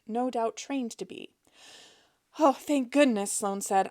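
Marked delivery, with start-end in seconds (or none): 2.4-3.3 gushing fake relief, a touch louder; mock relief. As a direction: put on grateful relief for the agent. Feigned relief on phone.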